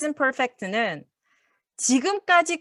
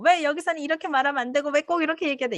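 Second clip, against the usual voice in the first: pestering question; 5 semitones higher, much faster than usual. Retold or imitated. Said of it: "원어민들한테 붙들고".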